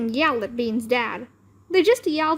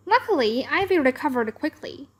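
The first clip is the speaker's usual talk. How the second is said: ordinary delivery, nothing marked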